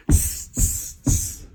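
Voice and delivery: sharp voiceless monkey "tss"; monkey chatter. A narrator's reading. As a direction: imitate monkeys with short hisses. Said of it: "stamp their feet back and said".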